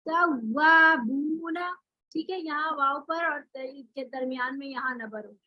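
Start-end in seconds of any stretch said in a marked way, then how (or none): 0.1-1.8 तव्वाबूना read very slowly, lips rounded on the doubled व; correct tajweed reading. Shown as a demonstration. Teacher modelling the correct तव्वाबूना for students, slow and rounded. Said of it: "होंठ गोल करके वाव पढ़ते हैं".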